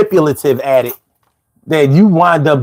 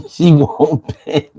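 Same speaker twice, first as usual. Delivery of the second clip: slow, amused, laugh in the voice; slower than usual. Real, not acted. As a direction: chuckle through the words. Laughing at a viewer comment.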